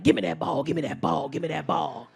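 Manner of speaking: low, loud imitation of the kid demanding; partner's little kid. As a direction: chant it low and insistent, imitating a child. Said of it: "he say the weirdest shit to me".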